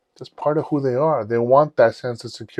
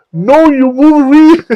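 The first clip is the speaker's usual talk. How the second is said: very high, very loud, drawn-out correction; adamant fan shout; 12 dB louder, slower than usual. Retold or imitated. Fan correcting the actor on stage, adamant shouting. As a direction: shout it slowly, refusing to accept otherwise.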